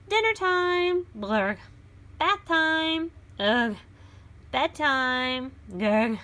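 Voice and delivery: put-on lively read-aloud for children; storybook reading. A narrator's reading. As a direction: read it like a picture book to a class. Reads picture book aloud.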